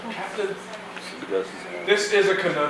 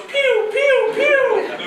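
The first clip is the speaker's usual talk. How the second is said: high pew pew blaster noise; squeaky laser gun sounds; gun sound effect; 4 dB louder, much slower than usual. Shown as a demonstration. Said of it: "It goes".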